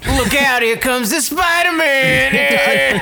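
high, slow booming announcer; hammed-up shout. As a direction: hype it like a cheesy announcer. Acted comic announcement.

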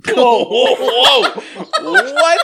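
loud, high laughing outburst; shocked laugh. Real, not acted. Shocked reaction to kissing clock.

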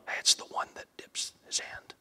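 soft unvoiced whisper; Jesus secretly whispering to John. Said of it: "he reveals it to John".

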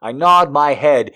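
usual speaking voice

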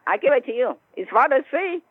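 ordinary talk

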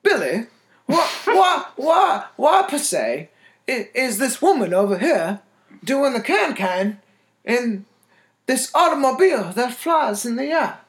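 a bad Southern accent, played for laughs; mock Southern accent. Asking Billy about the woman in a mock Southern accent.

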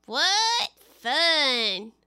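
raspy voice with eagle shrieks. Acted. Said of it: "griffin chuckles".

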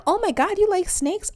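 high-pitched gushing question; mock amazed coworker. Coworkers reacting to her claimed snake hobby, mock amazed. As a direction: mimic exaggerated polite amazement. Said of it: "They're like".

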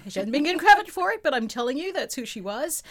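high, fast and loud; excited. Real, not acted. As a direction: burst out with it. Laughing emphatic aside about Oprah.

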